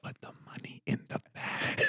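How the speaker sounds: very soft mock ASMR whisper; whispered robbery demand. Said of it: "but everything was whispered".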